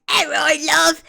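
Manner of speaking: loud, cheeky Cockney demo; Cockney accent. Shown as a demonstration. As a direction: call it out in broad Cockney. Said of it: "the Cockney accent".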